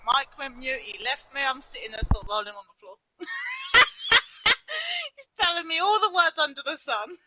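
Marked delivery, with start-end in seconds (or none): 3.2-4.2 shrieking high laugh, real; breathless high-pitched cackle; high squealing laugh. Real, not acted. Rolling on the floor laughing.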